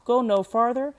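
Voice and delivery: ordinary delivery, nothing marked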